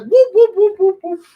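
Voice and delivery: very high, loud dog woops; Curly. Retold or imitated. Imitating his dog barking at everybody, high loud comic woops. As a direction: yelp like a slapstick stooge. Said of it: "he sounds like Curly from the Three Stooges".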